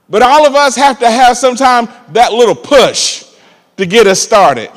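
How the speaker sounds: loud, high-pitched preaching; fiery gospel preacher; preaching at full force. Acted. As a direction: preach it out to the congregation.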